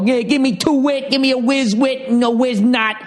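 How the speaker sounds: exaggerated Philly ordering voice; Philly accent. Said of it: "that Philly thing".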